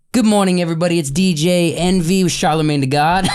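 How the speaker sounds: loud, upbeat morning DJ greeting; radio host voice. Retold or imitated. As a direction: boom it out like a morning DJ.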